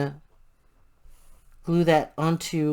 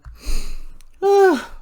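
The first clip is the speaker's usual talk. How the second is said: yawn; 9 dB louder than usual. Real, not acted. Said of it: "I'm yawning".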